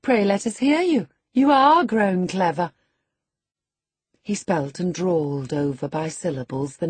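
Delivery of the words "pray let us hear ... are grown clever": mocking praise. A narrator's reading. Teasing Hareton.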